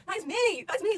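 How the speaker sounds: high, loud burst of delight; excited recognition. Real, not acted. Fan relating to a beloved character, high and excited. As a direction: squeal it, thrilled to relate.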